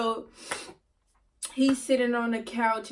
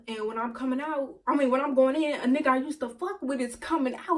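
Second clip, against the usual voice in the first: ordinary delivery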